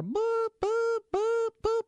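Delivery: high, even electronic beeping; imitated alarm beeps. Hearing the kitchen alarm from the couch, high mimicked beeps.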